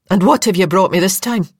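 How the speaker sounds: a Scottish nurse demanding to know; Scottish woman sizing up a new arrival. Acted. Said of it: "a Scottish voice demanded".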